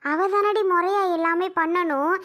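normal talking voice

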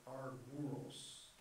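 arguros said slowly, each syllable laid out; slow Greek word. Shown as a demonstration. Teacher showing the class the Greek word arguros, slow and careful. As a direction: spell out arguros by ear, slowly. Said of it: "And that's this word".

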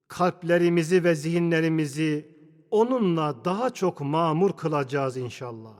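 usual speaking voice

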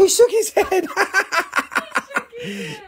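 squealing through laughter; high giggly disbelief; laughing high. Real, not acted. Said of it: "laughing repeat".

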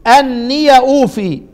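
أني أوفي recited slowly with the ya opened; Quranic recitation. Shown as a demonstration. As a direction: chant it slowly, open ya. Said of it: "زي ما أنا قرأت كده". (تلاوة أني أوفي بفتح الياء)

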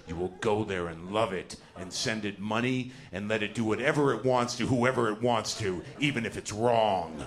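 ominous voice of indoctrination, insistent; relentless drumbeat. Acted. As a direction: chant it like a command drummed into someone. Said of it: "there's this drumbeat".

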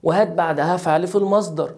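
ordinary talk

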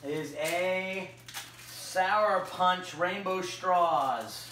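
each word stretched out for suspense; slow, drawn-out game-show reveal; slow dramatic reveal. Acted.

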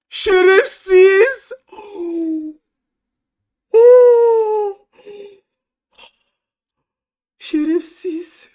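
stretched, slow, high wail of an insult; drawn-out high curse. Real, not acted. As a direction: moan the insult in real despair. Cursing after the fall.

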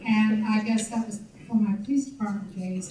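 ordinary talk, nothing marked

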